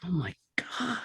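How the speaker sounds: breathless whispered Oh my God; shocked whisper. Retold or imitated. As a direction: whisper it, stunned by the buffalo head. Appalled at the buffalo head.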